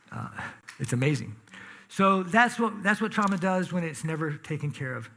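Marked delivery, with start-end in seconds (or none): none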